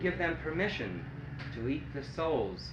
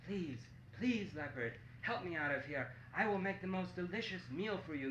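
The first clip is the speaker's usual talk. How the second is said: soft, higher begging voice; weak monkey pleading; 4 semitones higher, 6 dB quieter than usual. Acted. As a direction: beg softly, worn out and weak. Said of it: "very tired and very weak".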